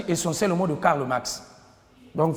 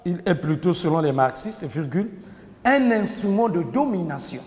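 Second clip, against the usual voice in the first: dictating. Shown as a demonstration. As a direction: dictate phrase by phrase, pausing between. Teacher dictating to class. (dictée lente, en détachant chaque groupe de mots)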